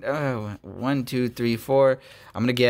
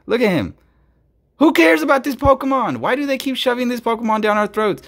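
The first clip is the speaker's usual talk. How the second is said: exasperated rant; 6 semitones higher, 4 dB louder than usual. Real, not acted. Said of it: "I'm saving my voice. I'm not screaming".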